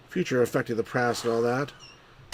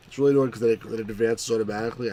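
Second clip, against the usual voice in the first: ordinary talk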